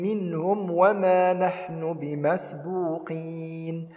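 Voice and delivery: slow, chanted tajweed recitation; measured tarteel chant; Quran recitation. Shown as a demonstration. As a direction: recite it melodically, drawing out the madd. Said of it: "نقول".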